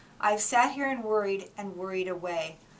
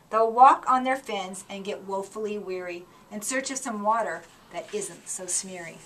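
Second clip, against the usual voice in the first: ordinary delivery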